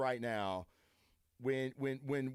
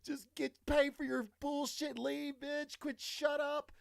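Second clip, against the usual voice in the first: exasperated inner rant; 13 semitones higher than usual. Retold or imitated. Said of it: "and I'm like".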